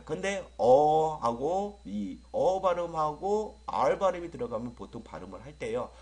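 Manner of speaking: normal talking voice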